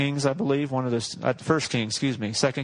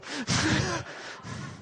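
high laugh; 13 semitones higher, 6 dB quieter than usual. Real, not acted. Laughs at own line.

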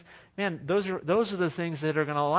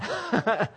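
short laugh; 5 semitones higher than usual. Real, not acted. Laughs at his own punchline.

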